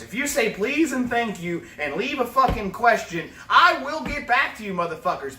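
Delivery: ordinary delivery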